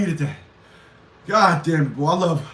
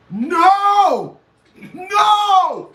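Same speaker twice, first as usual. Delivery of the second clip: loud high protesting yell; a real amused outcry; laughing shout; 9 semitones higher, 4 dB louder than usual. Real, not acted. Laughing outburst at a chat comment.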